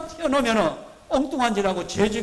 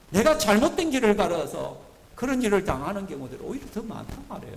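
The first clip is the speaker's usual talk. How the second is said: usual speaking voice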